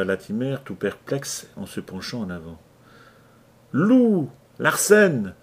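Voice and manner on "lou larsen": loud, high breathless answer; panting shout. Acted. Larsen answering from the pile, panting shout. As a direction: gasp out the name with effort. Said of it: "une voix haletante".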